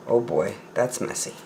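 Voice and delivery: low, soft muttered comment; muttered aside. Acted. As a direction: mutter it under your breath. Muttered aside reading story.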